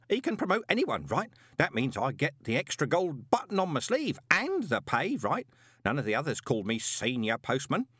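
high-pitched, animated muttering to himself; agitated postman thinking aloud; grumbling postman. A narrator's reading.

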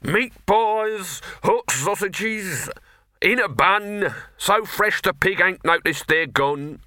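high, bellowing market cry; street vendor's shout. Acted. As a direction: hawk your pies to the whole street. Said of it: "raised his voice to a shout".

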